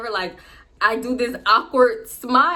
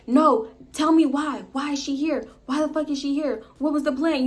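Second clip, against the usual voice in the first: higher, pressing retold demands; demanding why; 5 semitones higher than usual. Girlfriend pressing boyfriend on the plan, heated. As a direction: insist on the reason.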